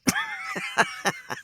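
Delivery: very high, delighted squeal of laughter; shrieking laugh. Real, not acted.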